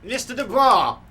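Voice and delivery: full shout of the name. Acted. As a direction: yell to break through his stupor. Trying to snap Harry out.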